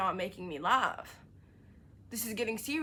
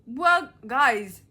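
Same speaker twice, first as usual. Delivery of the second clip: sarcastic; 3 semitones higher, 10 dB louder than usual. Real, not acted. Mocking reviewer, sarcastic.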